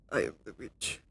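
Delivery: quiet low aside; low hushed witch line. Shown as a demonstration. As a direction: drop it low and hush it like a lurking witch. Repeats witch line two ways.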